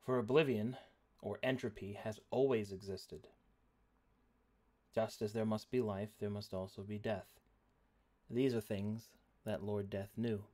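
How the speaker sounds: dramatic narration. Acted. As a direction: set the scene with gravity. GM narrating lore.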